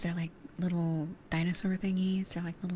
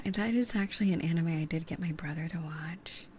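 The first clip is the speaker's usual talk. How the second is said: whispering. Real, not acted. ASMR whisper chat.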